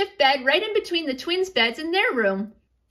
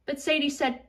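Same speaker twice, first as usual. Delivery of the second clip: ordinary delivery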